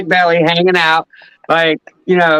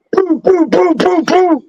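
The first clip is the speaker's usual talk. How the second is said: high-pitched "Boo, boo" beats mimicking a knock on the door; imitated cop knock; 11 semitones higher, slower than usual. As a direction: thump out the knock with your voice, high and even. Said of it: "on my door".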